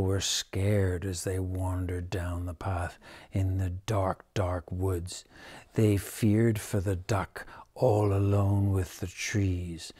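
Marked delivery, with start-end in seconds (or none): 0.0-5.3 soft unvoiced whisper; spooky whisper. A narrator's reading. Narrator whispers the scary walk to children. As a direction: hush it like a scary secret.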